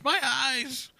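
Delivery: high-pitched mock cry of "My eyes!"; comic high yelp, imitating a meme; imitated meme yell. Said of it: "the guy who yells".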